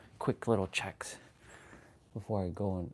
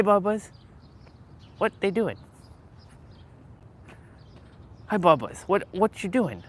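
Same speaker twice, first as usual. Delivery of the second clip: higher cooing pet talk; 8 semitones higher than usual. Real, not acted. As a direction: coo at the kitties like babies. Cooing at cats in street.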